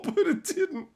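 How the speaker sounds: very high giggly denial; laughing. Real, not acted. Laughing at surprise success.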